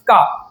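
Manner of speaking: का cut off clean and on its own; isolated first sound. Shown as a demonstration. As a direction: say just का, short and clear. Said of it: "का निकला ना".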